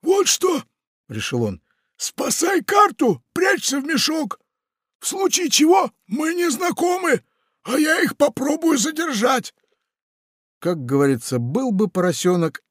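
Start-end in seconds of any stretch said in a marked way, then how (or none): 0.0-9.5 commanding pirate. Acted. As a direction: bark the plan fast. Pirate orders Funtik into sack.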